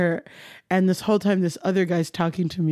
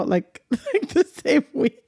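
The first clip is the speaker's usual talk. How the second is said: higher voice breaking into laughter; giggling at her own confession; laughing; 10 semitones higher, slower than usual. Real, not acted. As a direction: laugh through the words.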